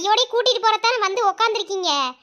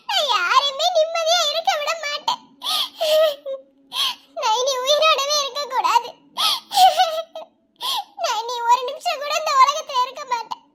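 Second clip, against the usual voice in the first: high, weeping despair; sobbing farewell; 7 semitones higher than usual. Acted. Girl begs her parents and Mithun to forgive her, sobbing and high. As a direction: choke out a last goodbye. Girl's farewell before suicide.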